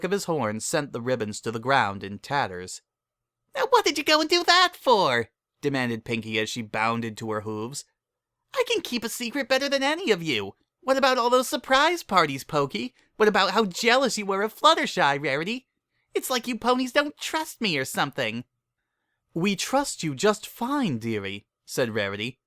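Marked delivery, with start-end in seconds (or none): 3.5-5.3 much higher, louder squeaky pony demand; bouncy indignant squeal; high excited filly voice; Pinkie, indignant. A narrator's reading. 8.5-18.4 bouncy indignant squeal; high excited filly voice; Pinkie, indignant. A narrator's reading. 19.3-21.5 higher, slow reassuring lady; raised, drawn-out calm; Rarity, soothing. A narrator's reading.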